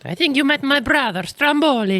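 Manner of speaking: high mock Italian mobster; Italian accent. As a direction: ham up an Italian uncle. Joke voice as Italian brother.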